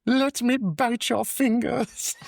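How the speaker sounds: very high, slow menace; high demon voice. Retold or imitated. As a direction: threaten in a squeaky villain voice. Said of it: "It's like".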